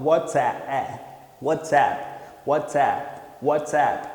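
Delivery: "WhatsApp" repeated slowly, open vowel, falling each time; drilled pronunciation. Shown as a demonstration. Teacher drilling the class, slow repeated model. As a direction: drill the word "WhatsApp" several times. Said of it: "o som de E, esse E aberto com A".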